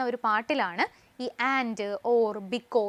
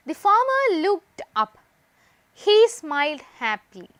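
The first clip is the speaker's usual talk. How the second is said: slow dictation; 3 semitones higher, 5 dB louder, much slower than usual. Shown as a demonstration. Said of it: "അപ്പൊ ഇവിടെ നോക്കിയേ".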